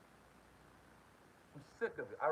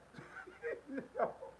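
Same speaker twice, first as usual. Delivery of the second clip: breathy giggle; 9 semitones higher, 8 dB quieter than usual. Real, not acted. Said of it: "You know why I'm laughing right now?".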